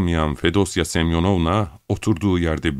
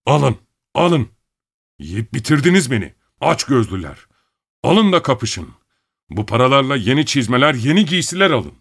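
furious shouting, higher and louder; shaking with anger; 6 semitones higher, 3 dB louder than usual. Acted. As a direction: rage boiling over. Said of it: "Bedeni sinirden titriyordu".

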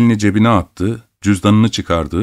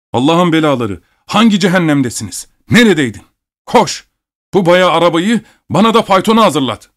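high, raised, scolding outburst; furious orders; 8 semitones higher than usual. A narrator's reading. Abogin storming at the late servant, furious. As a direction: curse and fire off commands in a high voice.

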